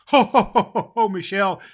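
big, loud, high Santa belly laugh; jolly ho ho ho. Acted. Santa laughs merrily at Michelle's letter. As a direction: boom out the ho ho hos.

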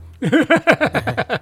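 a short high burst of laughter; laughing. Real, not acted. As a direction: laugh at your own joke. Laughs at own joke.

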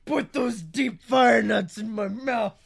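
slow hammed-up yell; mock shout. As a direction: bellow it slowly for laughs. Hammed demand amid laughter.

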